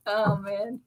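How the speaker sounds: ordinary talk, nothing marked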